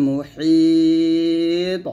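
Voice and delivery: "মুহিত" with the ই held very long and loud, final ত bounced; long madd with qalqalah. Shown as a demonstration. Teacher corrects the case ending, "মুহিত" stretched with bounced ত. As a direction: draw out the ই of "মুহিত", release the ত with an echo. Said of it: "বলতে হবে".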